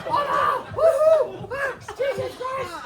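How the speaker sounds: high laughing panic; scared horror game streamer. Acted. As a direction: shriek at the bat swooping by. Mock panic at imaginary creature.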